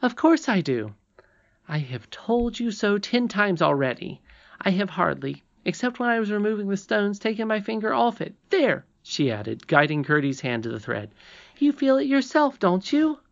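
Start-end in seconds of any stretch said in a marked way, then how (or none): 0.0-8.8 high-pitched, emphatic princess voice; higher character voice, stressing the point; insistent young princess. Acted. Irene speaks to Curdie. 11.6-13.2 very high, louder appeal; high, urging character voice; insistent princess. Acted. Irene urges Curdie to feel.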